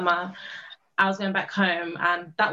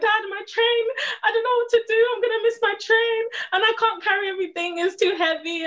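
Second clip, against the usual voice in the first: higher, tearful retold panic; crying to dad; 11 semitones higher than usual. Student stuck with bags crying to her dad, tearful and panicked. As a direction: relive the breakdown in a higher, half-crying voice. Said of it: "I was just crying and breaking down".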